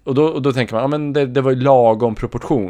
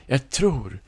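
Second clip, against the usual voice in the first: mjukt begrundande psykologröst; lågmäld och eftertänksam barnpsykolog; 2 semitones higher, 6 dB quieter than usual. Acted. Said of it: "barnpsykologen eller vad hon var". (dämpad, fundersam psykologröst)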